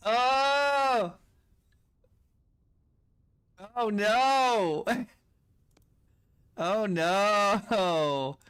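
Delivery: loud, drawn-out amused wailing; dismayed wail. Real, not acted. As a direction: wail in half-laughing horror at your blunder. Gamer wails over own blunder.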